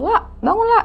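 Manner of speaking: high, light imitation of calling someone awake; retold wake-up call. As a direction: imitate trying to rouse a friend. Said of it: "dipanggil tuh".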